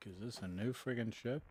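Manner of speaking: usual speaking voice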